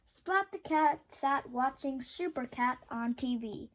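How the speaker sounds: ordinary delivery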